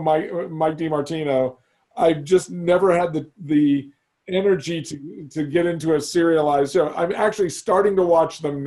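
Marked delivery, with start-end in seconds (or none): none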